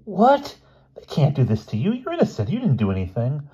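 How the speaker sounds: high, agitated protest; shocked outrage. Acted. Boy defending his expelled roommate, high shocked protest. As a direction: burst out in disbelief.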